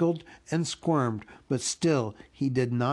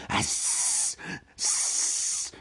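long voiceless creature hiss; Gollum's upset hiss; 4 semitones lower, 4 dB quieter than usual. A narrator's reading. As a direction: hiss in frustration, drawn out. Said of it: "more upset than ever".

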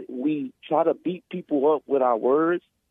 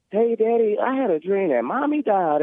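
high childlike voice, lightly put on; imitating his little daughter; 8 semitones higher than usual. Father retelling daughter's dream, higher child voice. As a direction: play the small girl telling her dad a dream.

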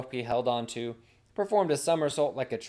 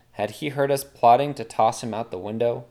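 usual speaking voice

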